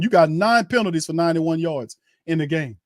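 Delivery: ordinary talk, nothing marked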